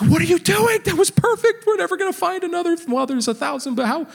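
put-on high, whiny kid voice protesting; upset five-year-old son. Dad imitating his son upset over the shell. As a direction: play the outraged little boy. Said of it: "He was like".

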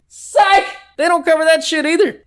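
very high, squealed punchline; gleeful mock gotcha.

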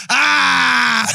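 sudden loud yelp of shock; loud pained cry as he hits the ground; mock scream. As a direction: blurt out a startled scream. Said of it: "first thing Peck done was go".

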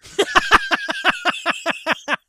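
shrieking burst of laughter; real cackle, high and loud. Real, not acted. Big laugh at joke.

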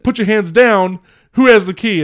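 exasperated retold shouting; mock yelling. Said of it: "He starts, like, yelling".

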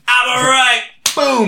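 mock-triumphant showman yell; hammed-up hype. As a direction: crow it to the crowd like a cheap comic. Hammed-up punchline celebration.

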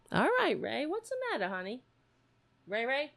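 very high soft cooing at the cat. Real, not acted.

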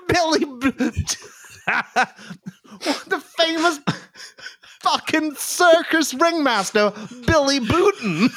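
giggling through the words; cracking up mid-sentence; laughing. Real, not acted. Corpses at partner's flub.

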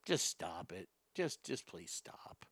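low, soft, slow plea; exasperated murmur. Real, not acted. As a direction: beg them to stop, quietly. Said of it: "I'm getting worked up".

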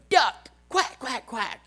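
put-on very high little girl quacking; squeaky kid duck; child quacking. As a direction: daughter copying dad in car, very high child quack. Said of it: "And she'll go".

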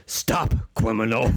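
speech-impaired superhero challenge; Batman. Acted. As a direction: confront a crook in a muddled superhero voice. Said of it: "give me an example of Batman".

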